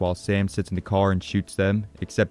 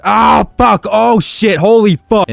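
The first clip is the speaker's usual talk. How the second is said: startled yelling; 13 semitones higher, 12 dB louder, slower than usual. Real, not acted. Sudden jump scare mid-sentence.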